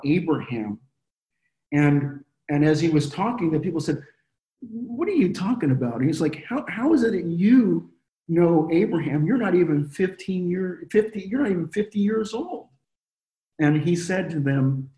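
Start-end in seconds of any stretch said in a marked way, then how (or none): 4.6-12.7 higher, mocking disbelief; incredulous challenge. Pharisees scoffing at Jesus, higher, incredulous and mocking. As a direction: scoff at him like a doubting Pharisee.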